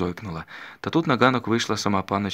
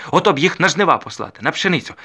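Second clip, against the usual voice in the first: 1 scornful outburst; 8 semitones higher, 9 dB louder than usual. A narrator's reading. Dokiia scornful about the gentry.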